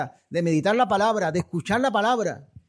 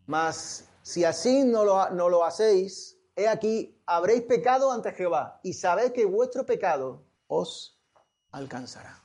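scripture reading; 2 semitones higher, slower than usual. Acted.

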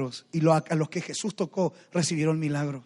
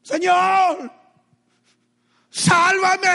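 very high, full-force scream for rescue; desperate cry; 12 semitones higher, 11 dB louder, much slower than usual. Retold or imitated. Drowning Peter to the Lord, desperate screamed cry. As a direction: shriek it out for your life. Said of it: "¿Cuál fue el clamor de Pedro?".

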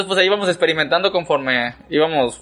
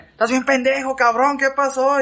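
higher, far-off voice scolding, slower at the end; retold scolding; 7 semitones higher than usual. Relatives scolding the son after he fell, mock angry.

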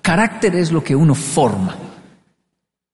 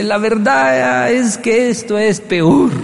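slow, stretched-out, higher voice; drawn-out confession; 5 semitones higher, much slower than usual. Acted. Someone opening his hand to confess, drawn-out admission. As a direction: drag the admission out theatrically. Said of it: "abre el juego y dice".

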